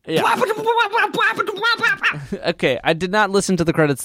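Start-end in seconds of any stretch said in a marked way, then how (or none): 0.3-2.3 high burst of laughter. Real, not acted. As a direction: let out a laugh. Laughing at comment.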